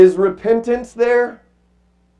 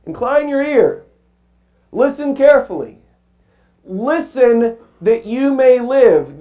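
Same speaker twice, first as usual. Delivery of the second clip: higher, pressing repetition of the verse; emphatic scripture; 6 semitones higher, 3 dB louder than usual. Acted. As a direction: urge the congregation to listen. Scripture quoted again.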